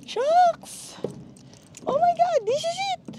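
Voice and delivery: very high excited squeal; excited shriek. Real, not acted. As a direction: squeal with thrill. Thrilled at the view.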